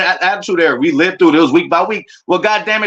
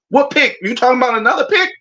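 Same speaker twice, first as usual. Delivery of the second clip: mock disbelief; 8 semitones higher than usual. Retold own confused reaction.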